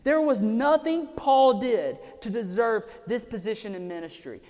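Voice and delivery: loud, high, driving sermon; preaching at full force. Real, not acted. Preacher proclaiming grace to the congregation, loud and driving. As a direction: preach it out with fire.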